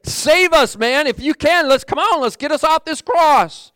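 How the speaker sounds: loud, high-pitched plea of a defiant criminal. Acted. Criminal on the cross demanding to be saved, defiant. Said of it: "That man that said".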